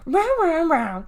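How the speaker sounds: growled. Acted. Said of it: "Deli growled".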